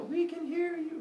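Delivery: high, sing-song crowd answer; crowd calling back. Acted. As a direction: answer together from the shore.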